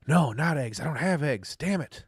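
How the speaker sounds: sudden dismay. Real, not acted. Realizes he has no eggs.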